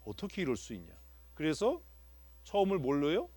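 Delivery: normal talking voice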